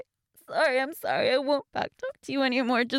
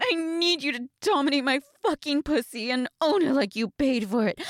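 ordinary talk

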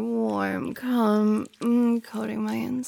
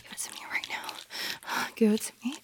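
demanding whisper; 7 dB quieter than usual. Acted.

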